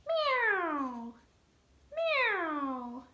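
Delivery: high, loud drawn-out meow; catbird mew. Shown as a demonstration. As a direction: mew like a hungry cat. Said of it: "Catbird sounds like a hungry cat".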